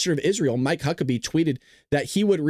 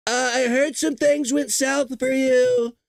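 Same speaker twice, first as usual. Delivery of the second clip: high, slow, sheepish Trump voice; mock Trump voicemail; 7 semitones higher, slower than usual. Trump leaving an awkward voicemail for Iran, high sheepish mock. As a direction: play it innocent and awkward. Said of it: "He just go".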